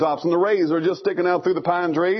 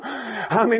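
ordinary talk, nothing marked

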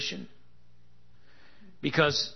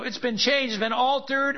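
mocking skeptic; 9 semitones higher than usual. Preacher mimics a doubter dismissing the Bible, scoffing. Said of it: "be willing to put that to the test and say".

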